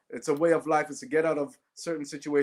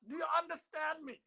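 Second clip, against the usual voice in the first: much higher mock shout of a furious mom; hammed-up Jamaican mother yelling at a C grade; outraged mother shouting; 14 semitones higher, 10 dB quieter than usual. Mother shouts at her son over his C grade, much higher. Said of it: "She goes".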